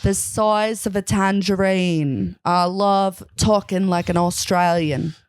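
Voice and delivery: drawn-out Australian accent; put-on Aussie accent, stretched words. Shown as a demonstration. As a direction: show off your Australian accent. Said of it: "I'm good at an Australian accent".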